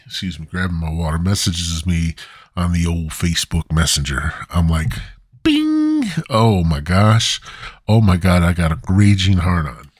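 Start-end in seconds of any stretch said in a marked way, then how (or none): 5.4-6.1 notification ding. Pretend. Imitated message notification sound.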